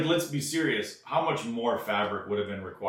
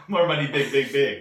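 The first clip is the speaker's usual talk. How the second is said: mock Chinese accent; 6 dB louder than usual. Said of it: "do it in a Chinese accent".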